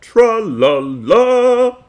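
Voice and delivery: very high, loud, drawn-out sing-song; Captain Underpants impression. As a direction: sing it like a triumphant cartoon hero. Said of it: "Did I do a good imitation of Tra-, Captain Underpants?".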